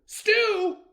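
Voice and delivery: very high, loud surprised stew; surprised delight. Acted. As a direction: perk up at the word stew. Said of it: "said Bre'r Fox".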